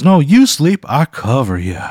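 high, loud blustery cartoon rooster voice; Foghorn Leghorn. Scene: the time-travelling quilt introduces itself to Pat, in a blustery cartoon rooster voice. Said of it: "Foghorn Leghorn".

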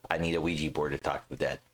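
put-on quick, offhand rattling through his own old thought; rattled off fast. To the cohost, rattling off his first thought fast. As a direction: reel off the obvious answer fast, mock-dismissive. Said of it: "And my thought was".

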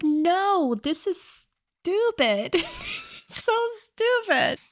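high, slow, drawn-out disbelief breaking into laughter; laughing exasperation. Real, not acted. Co-host dismissing the movie's ending as ridiculous, laughing exasperation. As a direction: laugh helplessly through drawn-out, high-pitched words.

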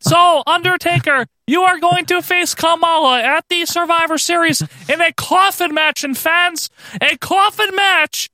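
hammed-up falsetto interviewer, stretching the key words; Ray Rougeau impression. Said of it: "Ray Rougeau interviewing The Undertaker".

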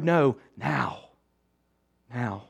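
a hushed, urgent now; soft insistent now. Real, not acted. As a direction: drop to a near hush on the final now. Urging listeners not to wait.

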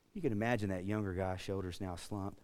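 usual speaking voice

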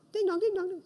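high sung ding-dong like a doorbell; playful mimicked doorbell ding-dong; doorbell chime imitation. Imitating the doorbell being rung.

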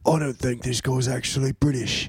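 mock British accent. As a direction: put on a very specific British accent. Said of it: "like a very specific British accent".

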